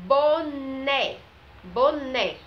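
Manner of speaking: BONÉ drawn out loud and high, with an open É at the end; open É sound. Shown as a demonstration. Teacher showing pupils the open sound of the accent, BONÉ loud with open final É. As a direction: ring out BONÉ on a stressed, open final vowel. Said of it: "ela fica com o som aberto".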